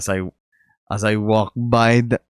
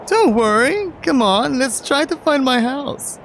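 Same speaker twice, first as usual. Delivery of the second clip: put-on high, upbeat young man; bright excited tour guide boyfriend; lifted pitch, cheerful guy; Mark's voice. Mark voiced again.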